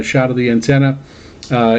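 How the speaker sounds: usual speaking voice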